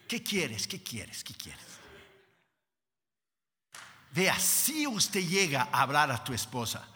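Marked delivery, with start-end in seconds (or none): 0.1-1.8 hushed, sinking lower with each repeat; acted tender surrender, fading to near silence; God won over. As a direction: ask softly, melting away. God softened, asks what Moses wants.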